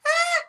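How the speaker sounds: high startled squeal; retold scream. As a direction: shriek like a starstruck fan. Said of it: "And I went".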